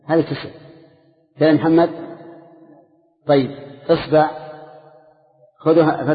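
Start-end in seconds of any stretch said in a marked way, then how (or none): none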